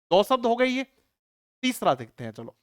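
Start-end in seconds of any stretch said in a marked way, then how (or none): none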